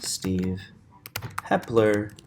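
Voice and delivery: stretched slow "monologue"; slow, elongated syllables; drawn out. Real, not acted. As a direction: draw the word out as you type. Drawing out word while typing.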